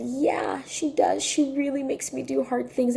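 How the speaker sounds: high-pitched complaining little girl, imitated; voiced young child. Niece confirming her teacher is hard, imitated child. Voicing upset niece agreeing.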